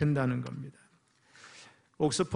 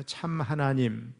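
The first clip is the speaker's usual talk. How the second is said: 참 하나님, 참 인간 spoken very slowly, each phrase apart; slow call and response; slower than usual. Shown as a demonstration. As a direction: say 참 하나님 and 참 인간 slowly for the congregation to echo. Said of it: "한번 따라해 보세요".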